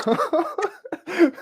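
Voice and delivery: squeaky high laugh; amused giggle. Real, not acted. Laughing at own remark.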